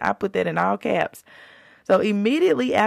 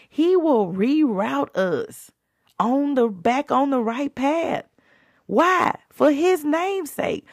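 higher, slower preaching call and answer; preaching climax; 8 semitones higher, slower than usual. Acted. Preaching to listeners about the straying sheep, rising. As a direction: call out the why, then answer it.

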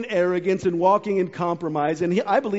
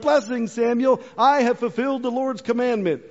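higher, self-satisfied greeting; smug Saul; 5 semitones higher than usual. Retold or imitated. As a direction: play Saul pleased with himself. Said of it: "And so he's like".

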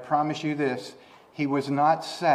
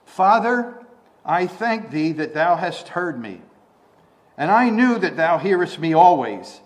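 Father called out high and loud; preacher voicing Jesus praying aloud, loud; loud prayer; 3 semitones higher, 7 dB louder than usual. Shown as a demonstration. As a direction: proclaim the prayer aloud to heaven. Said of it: "now carefully listen to what he prays out loud".

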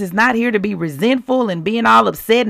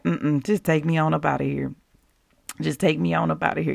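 mock weary sigh; 4 semitones lower, 6 dB quieter, faster than usual. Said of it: "on social media like".

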